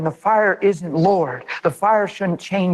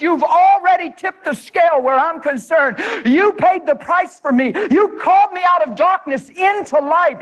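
preaching prayer; 7 semitones higher than usual. Shown as a demonstration. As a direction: pray it out with full fire. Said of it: "Just start like this".

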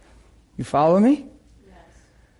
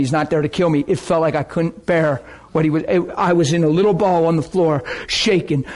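ordinary delivery

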